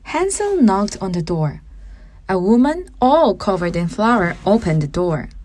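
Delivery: ordinary delivery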